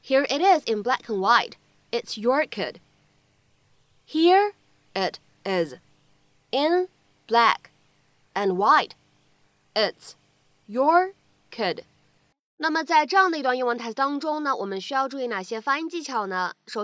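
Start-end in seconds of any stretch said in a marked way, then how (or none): 4.1-11.8 word by word. Shown as a demonstration. Model sentence broken up for learners.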